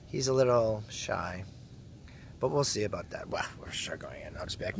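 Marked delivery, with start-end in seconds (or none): 2.4-4.8 hushed, low conspiratorial aside; sly whisper. Real, not acted. Plotting to get shy friend into club, whispered.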